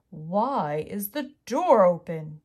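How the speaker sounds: slow, loud, gruff bear voice; big bear returning home suspicious; deep gruff Daddy Bear. Acted. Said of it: "in his deep, gruff voice".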